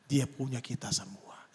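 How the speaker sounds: slow hushed whisper. Retold or imitated. Preacher gently tells the driver Jesus belongs to all, whispering. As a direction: breathe it softly like a secret. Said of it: "saya bicara pelan-pelan".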